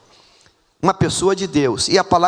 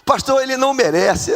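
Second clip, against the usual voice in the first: high-pitched mock complaint; whining protest; 8 semitones higher than usual. Preacher mimicking reluctant wives objecting to pastor, whining protest. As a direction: whine it up into a squeal.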